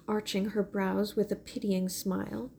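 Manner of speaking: ordinary delivery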